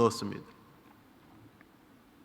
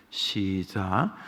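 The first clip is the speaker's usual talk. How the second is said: usual speaking voice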